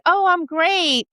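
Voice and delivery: a sing-song brush-off that hides misery; fake cheerful. Miserable person faking being fine.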